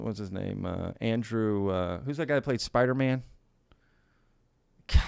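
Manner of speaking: normal talking voice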